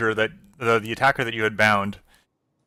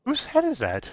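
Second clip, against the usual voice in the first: small-voiced onlooker; 14 semitones higher than usual. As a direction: ask it in a tiny, high voice. Second villager asks, high soft voice.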